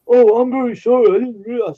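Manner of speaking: high, loud, simpering sorry; imitating a sheepish driver backing down; meek apology. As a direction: whine it like a caught tow driver.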